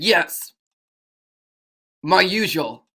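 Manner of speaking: strong-voiced hero ordering; firm customer's reply. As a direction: state it firmly.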